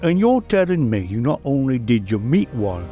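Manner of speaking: ordinary talk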